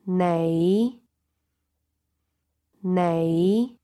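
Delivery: nei starting low and rising slightly. Shown as a demonstration. Teacher demonstrating the low rising tone. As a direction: begin nei low, then lift it a little. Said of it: "the low rising tone".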